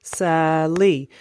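"Sally" split into two clapped beats, "Sal" then "ly"; clapped syllables. Shown as a demonstration. Parent clapping syllables for a child, "Sally" in two beats. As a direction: say "Sally" beat by beat, a clap on "Sal" and on "ly". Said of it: "Let's clap it out".

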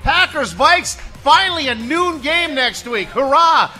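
loud, high game-show host shout; announcer voice. Acted. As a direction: boom it out to the crowd. Prize giveaway hype.

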